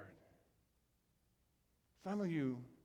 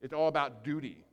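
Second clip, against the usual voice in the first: usual speaking voice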